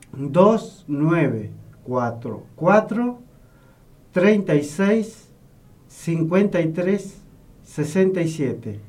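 slow, spaced-out phone number; slowly. Real, not acted. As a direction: repeat it slowly, group by group. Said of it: "lo vamos a ir repitiendo despacito".

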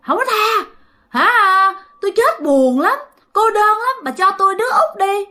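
high, insistent spirit voice; acted lonely ghost's high-pitched demand; unrelenting ghost. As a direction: refuse sharply, then press the demand high. Ghost refuses mercy, demands child.

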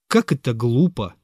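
ordinary delivery, nothing marked

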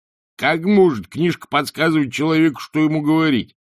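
indignant landlord voice; incredulous innkeeper. A narrator's reading. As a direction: demand it as if it's utter nonsense. Innkeeper incredulous about a talking book.

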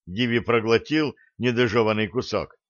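ordinary delivery, nothing marked

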